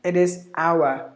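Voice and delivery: "hour" slowly as OW-uh; British OW-uh for "hour"; British pronunciation. Shown as a demonstration. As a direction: leave the R silent in "hour". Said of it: "The British".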